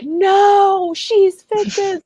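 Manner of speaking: high, insistent mock protest; indignant fan protest. A younger self insisting Molly belongs with Fitz, high indignant protest. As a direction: protest like a devoted teen fan. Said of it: "I would have been like".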